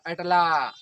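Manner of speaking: अटला called out loud with the final ला drawn long, as to someone far off; calling out a name. Shown as a demonstration. Teacher shows students how to call Atal from afar, loud and drawn out. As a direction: stretch the last vowel of अटला like hailing a distant friend. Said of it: "तो हम बुलाते हैं".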